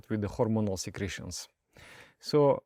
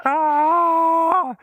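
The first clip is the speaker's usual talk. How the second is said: sudden high, loud yell; wild yell of a master at the tree; master's shout; 9 dB louder than usual. Retold or imitated. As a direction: blast out a startled shout. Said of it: "master is shouting".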